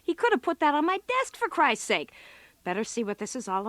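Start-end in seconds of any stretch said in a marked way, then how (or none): 0.0-2.1 much higher and fast, exasperated; fast exasperated griping; irritated outburst. Real, not acted. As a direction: vent your annoyance at the boss. Irritated by the boss's message.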